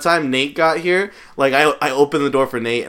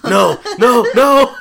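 rising, much louder no's; mock panicked yell; 11 semitones higher, 8 dB louder, slower than usual. Friend imitating Chris freaking out at the video, in a rising mock yell. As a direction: cry out more and more in mock horror.

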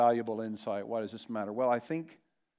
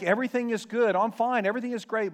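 higher, louder mock-upbeat insistence; pretending all's fine; 7 semitones higher, 4 dB louder, faster than usual. Preacher mimics people hiding their grief, falsely upbeat. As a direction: put on a show of being fine. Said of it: "When we act like".

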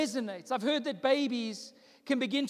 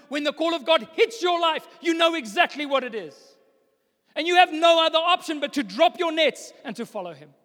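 loud, rising preacher's call; preaching at full force; 5 semitones higher, 8 dB louder than usual. Acted. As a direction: build to a shouting climax. Preaching climax.

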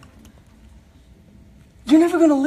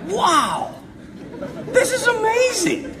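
much higher, slow and laughing; mock amazement; 10 semitones higher, slower than usual. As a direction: marvel at it with a laugh in the voice. Priest marvels at girl's reaction.

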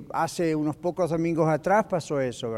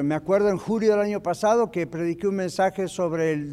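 ordinary talk, nothing marked

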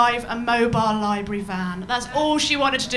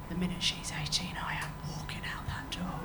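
whispered secret plan; 8 semitones lower, 15 dB quieter than usual. Retold or imitated. Friend whispering his plan to leave. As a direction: hush it like a confession.

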